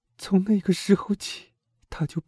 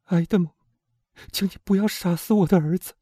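much higher, louder pleading woman's voice; desperate pleading mother; desperate plea; 12 semitones higher, 5 dB louder than usual. Acted. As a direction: beg him for your son's life. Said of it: "用一种绝望的口气说".

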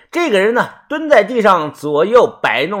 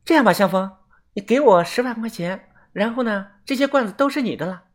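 high, soft tentative offer; hesitant bargaining; 5 semitones higher, 4 dB quieter than usual. A narrator's reading. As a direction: feel out the deal in a small voice. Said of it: "叶子犹豫了半天". (犹豫着轻声开价)

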